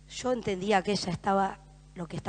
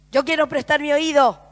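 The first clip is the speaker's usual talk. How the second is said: higher and louder, a firm declaration; preacher repeating the vow with force; 6 semitones higher, 11 dB louder than usual. Acted. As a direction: proclaim it as your own vow. Repeated line, louder take.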